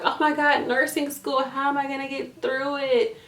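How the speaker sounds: high, hammed-up fretting over nursing school; mock panicked student. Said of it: "you wanna be like".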